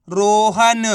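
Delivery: रोहन drawn out syllable by syllable; blended reading. Shown as a demonstration. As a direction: join the sounds slowly into one long रोहन. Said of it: "तो मिलके बन जाएगा". (रोहन को धीरे, खींचकर पढ़ते हुए)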